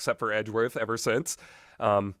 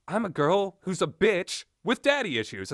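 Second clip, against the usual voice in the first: a lightly voiced mean girl, a bit higher; mocking Dahlia; 6 semitones higher than usual. Reviewer mocks Dahlia as a shallow villain, lightly mock voiced. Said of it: "who is just".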